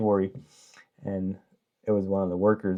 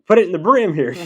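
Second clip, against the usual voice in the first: much higher, louder proud voice; simple Irishman; 10 semitones higher, 11 dB louder than usual. Retold or imitated. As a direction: beam it out, voice way up. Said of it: "is like".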